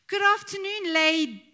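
high, bright mock teacher's hello; teacher greeting. Mimicking the drama teacher greeting pupils, high and loud. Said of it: "I can't say that, can I".

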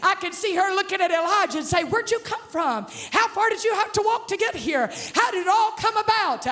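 high-pitched, driving sermon delivery; full-force preaching. Real, not acted. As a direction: preach the story with mounting excitement.

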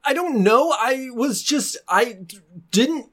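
high, halting, upset voice; acted tearful helplessness; near tears. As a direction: stammer as if about to cry. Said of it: "I think he's starting to cry".

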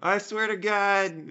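high, slow comic oath; mock pleading. Accused diviner swearing he is innocent, mock pleading. Acting pagan denying fortune-telling.

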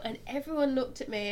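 usual speaking voice

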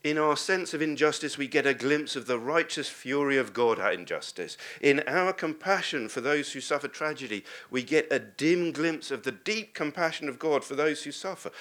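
normal talking voice

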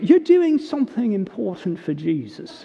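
put-on Southern accent with dropped r's. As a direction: lean into a mock Southern accent, letting the r's go. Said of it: "kind of Southern accent".

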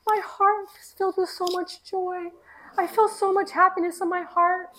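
high-pitched imitation of a tearful boy; high, tearful retold child voice; crying child. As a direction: imitate a little boy overwhelmed with joy. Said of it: "he's crying".

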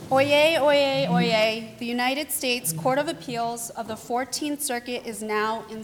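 loud formal court crier call; town crier. Real, not acted. Bailiff opens court session.

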